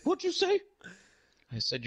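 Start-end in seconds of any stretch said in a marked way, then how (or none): 0.0-0.6 high, offended clown disbelief; incredulous challenge in a falsetto clown voice; Ronald McDonald. Retold or imitated. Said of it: "and he's like".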